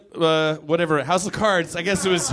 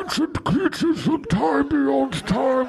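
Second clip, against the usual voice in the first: slow, higher hushed British fantasy voice; Game of Thrones actor; 7 semitones higher than usual. Retold or imitated. Mocking Game of Thrones whisper acting, hushed British. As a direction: mumble grand fantasy lines. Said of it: "they're like".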